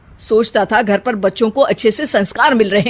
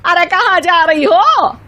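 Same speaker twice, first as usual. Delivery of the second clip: slow, higher, puzzled call; calling after them; 8 semitones higher, 3 dB louder than usual. Acted. Mother-in-law calls after her three daughters-in-law, puzzled. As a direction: stop them as they walk off.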